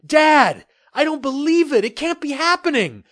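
high and loud, can't believe his eyes; stunned disbelief. Acted. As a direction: blurt it out high and loud in shock. Son stunned by the ship.

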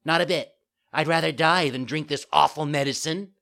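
high, loud stubborn puppet; defiant child. A narrator's reading. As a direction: declare it with bratty bravado. Puppet defies death.

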